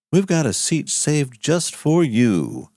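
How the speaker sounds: ordinary delivery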